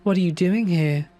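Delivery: high, thin creature voice parroting back; eerie lamb's high echo; thin mimicking lamb. A narrator's reading. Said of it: "it mimicked me back in that thin voice".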